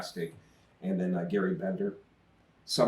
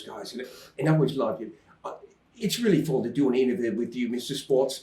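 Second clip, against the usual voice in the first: lightly imitated British accent, a little higher; 4 semitones higher than usual. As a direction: mimic a British interviewee's accent. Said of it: "I always like that, uh, British accent".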